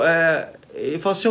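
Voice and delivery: ordinary delivery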